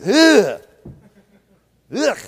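loud, high "ugh" of disgust; loud mock-revolted grunt; disgusted groan. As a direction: gag in revulsion. Disgust at soft preachers.

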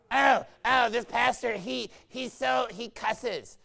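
mock scandalized gossip. Mimicking a gossiping complainer.

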